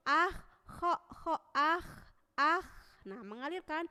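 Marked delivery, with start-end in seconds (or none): 0.1-2.6 "kho" loud with the air rushing through; flowing kho demo. Shown as a demonstration. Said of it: "ada suara yang mengalir".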